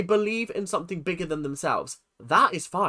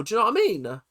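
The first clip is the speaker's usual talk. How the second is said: normal talking voice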